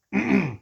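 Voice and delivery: sharp throat clearing. Real, not acted. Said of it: "excuse me".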